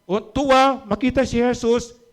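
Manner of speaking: high, rising excited preaching, quickening; preaching at full force. Acted. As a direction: lift the congregation with rising joy. (masiglang pangangaral, mataas ang boses)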